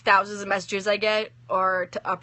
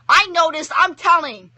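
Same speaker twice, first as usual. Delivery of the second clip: tattletale yell; 8 semitones higher, 8 dB louder than usual. A narrator's reading. Child yelling to tattle.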